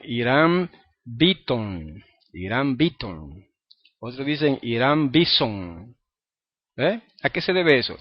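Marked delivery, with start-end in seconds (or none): 0.1-3.5 Beaton, the th said as a plain t, slow. Retold or imitated. Teacher slowly imitating another Puerto Rican version. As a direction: drag out Beaton with a hard t. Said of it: "Algunos puertorriqueños dicen".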